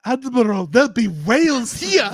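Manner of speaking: Star Trek quote. Retold or imitated. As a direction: call it out like a movie hero. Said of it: "Star Trek talk off".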